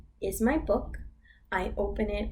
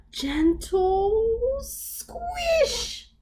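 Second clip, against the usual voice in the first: very high, very slow squish; squeaky squish; 13 semitones higher, 3 dB louder, much slower than usual. Acted. Teacher acts out squishing the ice cream. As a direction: draw out the squish, tiny and high.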